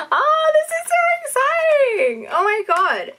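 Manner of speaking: very high, drawn-out excited squeal; real excitement. Real, not acted. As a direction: squeal with delight, stretching the words out. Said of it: "I'm so excited".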